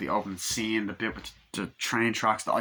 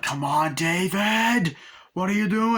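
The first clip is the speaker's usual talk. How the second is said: high, loud mock shout at a friend; mock yell; 9 semitones higher, 5 dB louder than usual. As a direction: yell it up high like a panicked teen. Retold character yelling at friend.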